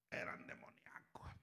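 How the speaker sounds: dark unvoiced whisper; ominous confidential whisper. Real, not acted. Whispered revelation.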